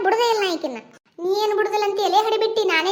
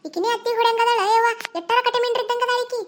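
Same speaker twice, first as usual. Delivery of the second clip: higher, raised complaining voice; indignant complaint; 5 semitones higher than usual. Acted. Complaining about the mother-in-law. (ನೆರೆಯವರ ಬಳಿ ಸಿಟ್ಟಿನ ದೂರು)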